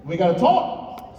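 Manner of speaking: high-pitched mom calling her son's name; scolding mom. Retold or imitated. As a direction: imitate a mom summoning her son. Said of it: "And my mom said".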